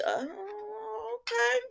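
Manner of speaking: high, slow, hesitant mock okay; reluctant okay. Sonny answering Nina, hammed-up reluctant okay. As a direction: drag out a grudging okay.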